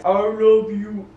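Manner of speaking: slow, put-on deep voice. Shown as a demonstration. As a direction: drop into a mock deep voice. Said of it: "trying to talk in a deep voice".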